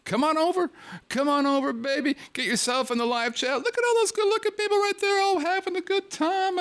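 high singsong pop diva voice; [name]. Retold or imitated. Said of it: "Like my good friend [name] says".

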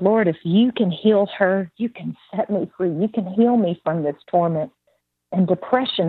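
normal talking voice

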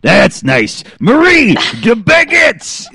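high, hammed-up French baker shout, drawn out; mock French shout. Retelling the movie baker's call to friends, mock French shout. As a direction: belt it like a cartoon French baker. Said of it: "And he's like".

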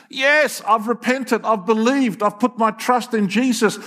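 high, eager declaration; eager convert. Acted. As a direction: declare it keenly like a new believer. Voices new believer at the door.

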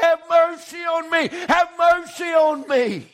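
crying out. Retold or imitated. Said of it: "he cried out".

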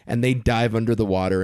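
ordinary delivery

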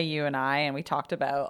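ordinary talk, nothing marked

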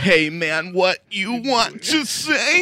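loud, slow mock-vampire delivery; Dracula. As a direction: ham up the singer's theatrical voice, rising high. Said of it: "gonna call it Dracula voice".

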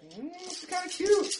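very high, cooing little voice; mock-adoring squeal; smitten aside. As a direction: coo it in a squeaky pitch. Acting out his involuntary attraction.

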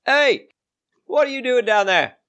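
high-pitched character holler; shouting gatekeeper. Acted. Gatekeeper screaming out to travellers on the road, high holler. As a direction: call down sharply from high in the voice. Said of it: "is going to scream out".

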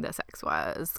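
breathy whispered aside. Acted. Recapping the show to her cohost, whispering. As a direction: drop to a hushed whisper, scandalized.

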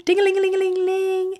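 high, sustained sing-song ringing; phone ring imitation. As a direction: trill it out like a ringing telephone.

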